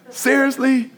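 incredulous. Retold or imitated. Preacher recalls a repeated small mishap, incredulous. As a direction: throw it up in disbelief. Mimicking own frustration.

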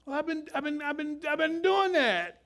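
preacher mocking the whiny young man. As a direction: whine that you did it since a kid. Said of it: "whiny might be".

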